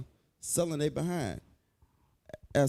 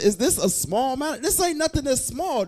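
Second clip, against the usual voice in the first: fiery preaching; 9 semitones higher, 4 dB louder than usual. Real, not acted. Preacher rising on scripture point.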